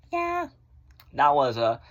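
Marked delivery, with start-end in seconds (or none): 0.1-0.5 high ironic yeah. Real, not acted.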